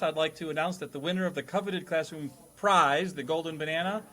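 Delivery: ordinary talk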